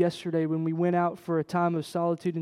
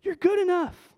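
reassuring reply; 12 semitones higher than usual. Acted. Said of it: "He says".

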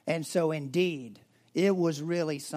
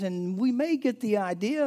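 ordinary delivery

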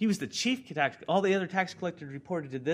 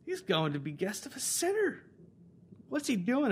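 high-pitched scandalized complaint; lightly mocking, affronted onlookers; grumbling crowd; 8 semitones higher than usual. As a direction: grumble in indignant disbelief. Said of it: "all the people began to grumble".

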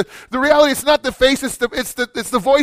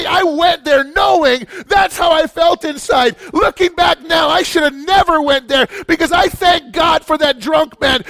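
impassioned sermon shout; full-force preaching; 8 semitones higher than usual. Real, not acted. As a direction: preach it out at the top of the voice.

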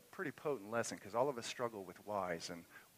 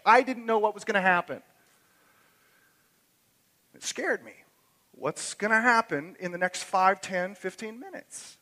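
much higher and louder, building passion; full-force preaching; 11 semitones higher, 12 dB louder than usual. Acted. Pastor driving home trust to the congregation, at full force.

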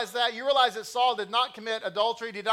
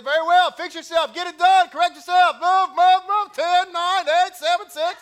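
much higher and louder barked commands, counting down; mock drill sergeant; 9 semitones higher, 11 dB louder than usual. Wife drilling her husband at home, mock shouted. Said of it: "she looks at me and says".

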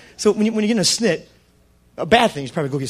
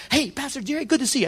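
higher, bright friendly greeting; cheerful Joe; 8 semitones higher than usual. Retold or imitated. Joe greeting the pastor at the restaurant.

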